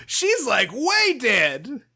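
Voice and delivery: hammed-up, high-pitched tactless doctor; indiscreet doctor. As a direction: blurt out grisly news in a high mock voice. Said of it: "the doctor wasn't quiet".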